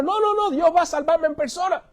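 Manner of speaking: high-pitched, insistent waving away of help; stubborn refusal. Acted. Drowning man turns down the speedboat, stubbornly insistent.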